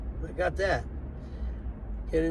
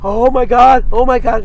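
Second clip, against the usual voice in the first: panicky; 6 semitones higher, 12 dB louder than usual. Retold or imitated. Drag queen panicking on phone.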